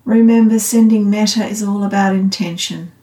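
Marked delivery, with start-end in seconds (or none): none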